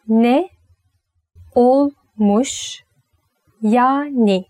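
"Ne olmuş yani" broken into slow, separate words; slow, split angry. Shown as a demonstration. Teacher showing a slow, defiant "Ne olmuş yani". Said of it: "it sounds angry".

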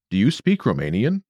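normal talking voice

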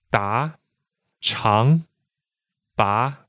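DA, CHANG, BA each rising; steady upward glide on "da, chang, ba"; second tone. Shown as a demonstration. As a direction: lift "da", "chang", "ba" like separate questions. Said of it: "some more examples of second tone words".

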